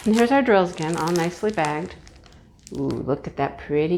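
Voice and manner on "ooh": ordinary talk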